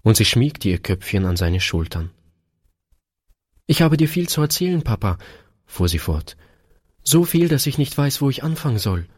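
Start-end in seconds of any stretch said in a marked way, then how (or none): 3.7-5.2 put-on high, quick child's voice; eager little daughter. A narrator's reading. Daughter with much to tell Papa, eager high voice. 7.0-9.1 put-on high-pitched little girl's voice; little daughter. A narrator's reading. Daughter telling Papa she missed him, high little-girl voice. Eager child addresses her father.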